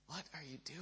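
very soft, baffled aside; quiet disbelief. Retold or imitated. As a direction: drop almost to nothing, as if stunned by him. Baffled aside to cheering man.

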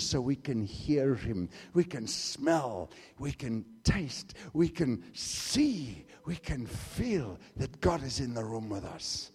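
low, hushed, slow; tender preaching; soft and slow. Acted. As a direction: draw them in gently.

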